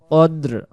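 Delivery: কদ্র with a short a and no vowel before r; কদ্র said as qadr, closed and short, not kadri. Shown as a demonstration. Teacher corrects a student on saying কদ্র. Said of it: "উচ্চারণ হবে কদ্র".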